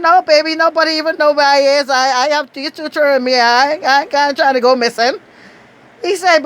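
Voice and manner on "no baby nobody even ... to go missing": high, louder, quick no-nonsense brush-off; playful mock indignation; sassy refusal. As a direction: turn him down like he's out of his mind. Refusing agent's invitation, retold.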